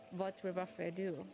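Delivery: ordinary talk, nothing marked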